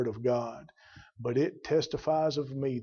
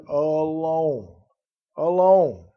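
"alone" drawn out and set apart, louder when repeated; stress on alone; 6 dB louder, much slower than usual. Shown as a demonstration. Preacher drives the last word home to his congregation, stressing "alone". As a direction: hit "alone" hard, then harder on the repeat. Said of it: "Let me stress that last word".

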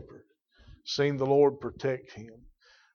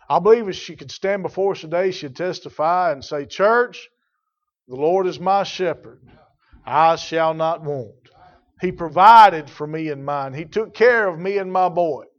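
loud, higher preacher testimony; testifying shout; 3 semitones higher, 7 dB louder than usual. Acted. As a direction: proclaim it to the church, rising. Widow testifying in church.

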